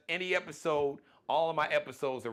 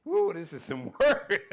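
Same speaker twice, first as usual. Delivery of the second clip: raised, louder quote breaking into laughter; laughing mock complaint; 5 semitones higher, 7 dB louder than usual. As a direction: playfully exclaim it, then crack up. Joking retold complaint, then laughing.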